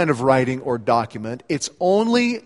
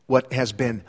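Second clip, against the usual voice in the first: normal talking voice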